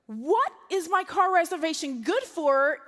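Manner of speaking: indignant retold outburst; exasperated outrage. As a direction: punch out each word in disbelief. Outraged at conditional car reservation.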